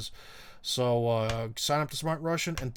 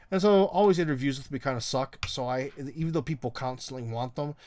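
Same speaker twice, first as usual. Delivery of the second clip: usual speaking voice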